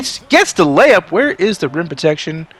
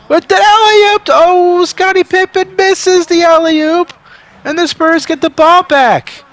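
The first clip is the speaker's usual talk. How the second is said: loud, much higher play-by-play shouts; excited yelling; 12 semitones higher, 6 dB louder, slower than usual. Real, not acted. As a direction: shout the call like the crowd is going wild. Wild finish of close game.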